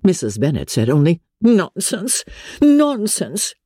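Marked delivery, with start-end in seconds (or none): 1.4-3.5 high, exclaimed dismissal; dismissive outburst. Acted. Wife rejecting her husband's offer, dismissive. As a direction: burst out in high-pitched scorn.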